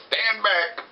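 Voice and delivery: high-pitched mock command. Demon tells woman to stand back, mock commanding.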